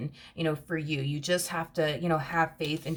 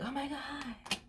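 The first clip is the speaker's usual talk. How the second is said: much higher, swooning gush; gushing new partner, over the moon; gushing lovestruck lover; 9 semitones higher than usual. Retold or imitated. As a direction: play the starry-eyed partner who can't believe their luck. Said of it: "this feminine energy feels like".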